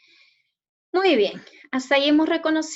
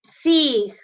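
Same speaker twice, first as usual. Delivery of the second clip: Sí very loud and drawn out, the strong syllable of insignia; stressed syllable; 2 semitones higher, 8 dB louder than usual. Shown as a demonstration. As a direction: punch Sí hard and hold it. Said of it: "sílaba con mayor fuerza de voz".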